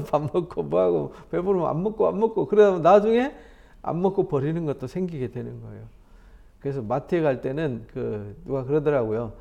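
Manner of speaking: normal talking voice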